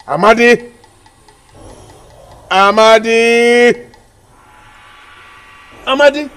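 loud, high summons, the name held long in the middle; calling out. Acted. Caller invokes Amadi's spirit, commanding call.

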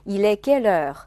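"Il est quelle heure" with "heure" stressed and held long at the end; long final heure. Shown as a demonstration. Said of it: "要保留". (句末heure重读长音示范)

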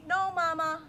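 put-on high, loud little girl's no; a stubborn little girl saying no; child refusing.

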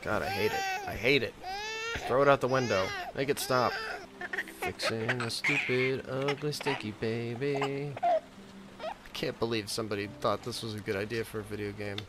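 ordinary talk

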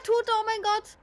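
high panicked cry; being chased. Real, not acted. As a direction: shriek as the killer comes. Killer coming after her.

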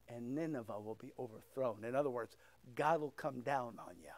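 soft, low preaching voice for bowed heads; hushed altar call. Acted. To the congregation with heads bowed, soft low preaching. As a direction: bring it right down to a near hush.

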